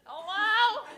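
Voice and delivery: a high, mock-chirpy phone hello; high mom hello. Comic telling her mom's phone habit, high chirpy hello.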